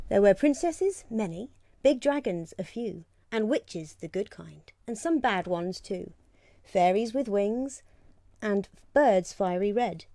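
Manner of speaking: lively rhyming read-aloud; storybook reading. Acted. Reads picture book aloud.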